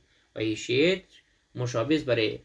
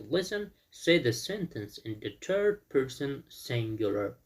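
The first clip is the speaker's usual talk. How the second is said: slow instruction; much slower than usual. Shown as a demonstration. Teacher reads exercise instruction.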